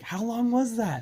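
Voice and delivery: high-pitched incredulous question; bewildered friend. Retold or imitated. Imitating stoned friend who lost track of time, high bewildered voice.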